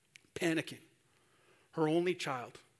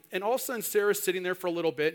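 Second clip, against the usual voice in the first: usual speaking voice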